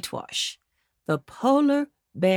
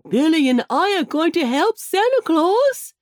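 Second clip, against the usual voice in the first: higher, louder quick little squirrel; chattering squirrel; 8 semitones higher, 5 dB louder than usual. Acted. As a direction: chatter it out excitedly. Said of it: "chattered Johnny".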